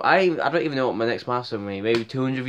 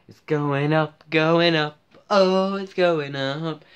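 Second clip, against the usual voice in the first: excited sing-song; 5 semitones higher, much slower than usual. Acted. Vlogger gloating over his channel's growth, building excited sing-song. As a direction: crow it out, stretching the last one.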